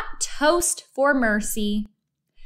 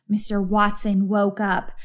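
ordinary delivery, nothing marked

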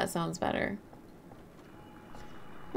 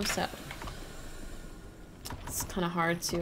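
ordinary delivery

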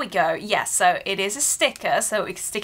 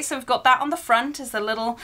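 usual speaking voice

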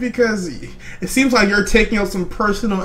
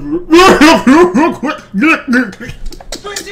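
high, loud imitation of his laugh; voicing the eater's laugh for viewers; imitated laugh; 9 semitones higher, 5 dB louder than usual. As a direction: mimic the guy laughing on screen. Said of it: "narrate his laugh for y'all".

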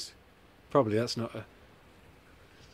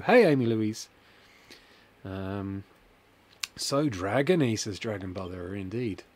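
ordinary talk

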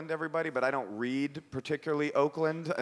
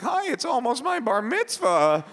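put-on high, slow squeaky kid; boy's real voice; 9 semitones higher, slower than usual. Thirteen-year-old calling a phone line, high childish voice. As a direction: pipe up like an excited young boy. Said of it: "you can't use your real voice".

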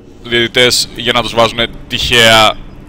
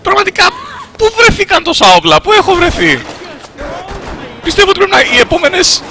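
very high falsetto complaint; panicked rant. Real, not acted. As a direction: squeal it out in exasperation. Rants under fire in game.

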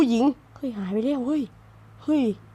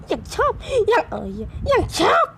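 high, whimpering mock sob; half-hearted teary whine; whiny fake crying; 11 semitones higher than usual. Real, not acted. Whining at game.

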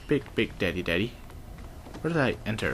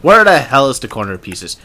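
loud, angry where the hell; frustrated shout; 3 semitones higher, 14 dB louder than usual. Real, not acted. Gamer fighting a hacking puzzle, frustrated shout.

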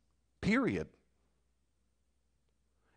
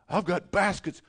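mock complainer; 5 semitones higher, 4 dB louder than usual. Voicing a complainer.

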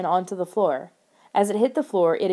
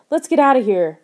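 loud, higher urgent shout; 5 semitones higher, 8 dB louder than usual. A narrator's reading. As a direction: shout to get everyone out fast. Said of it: "Lauren shouted".

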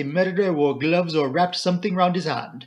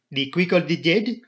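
put-on higher French-accented question; Poirot; 4 semitones higher, 3 dB louder than usual. A narrator's reading. Poirot tests the inspector, foreign accent. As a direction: ask pointedly in a French accent.

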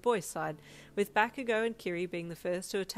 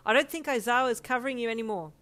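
loud, higher urgent explanation; shout; 5 semitones higher, 7 dB louder, faster than usual. A narrator's reading. Jiro telling Yin the teacher is not watching, shouting. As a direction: call it across to a teammate. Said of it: "Jiro shouted at you".

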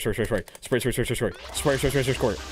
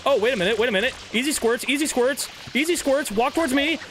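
high taunting; 12 semitones higher than usual. Pretend. Taunting enemy to approach.

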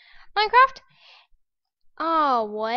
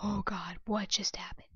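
usual speaking voice

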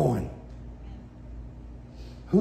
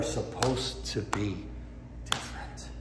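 whispered for effect; hushed emphasis; 6 semitones lower, 9 dB quieter than usual. Real, not acted. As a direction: drop to a whisper on the key word. Dramatic hush after loud preaching.

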